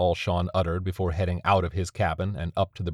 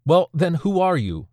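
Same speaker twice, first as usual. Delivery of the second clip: raised, higher demand; 8 semitones higher, 3 dB louder than usual. A narrator's reading. Sean demanding Carrie's identity, raised and higher. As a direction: press her for an answer. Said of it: "Sean demanded".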